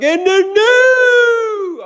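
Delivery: high, drawn-out fight-announcer bellow; hammed-up boxing announcer call, loud and stretched; Bruce Buffer announcer yell. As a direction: belt it like a ring announcer into someone's ear. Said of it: "He's, like, literally yelling in his ear".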